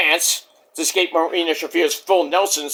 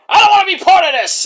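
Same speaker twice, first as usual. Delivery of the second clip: high, loud yelled protest; Roddy yelling; 11 semitones higher, 6 dB louder than usual. Retold or imitated. Wrestler refusing to take part, high yelled impression. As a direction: shout it out as an over-caffeinated complaint. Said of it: "Roddy comes in and yells".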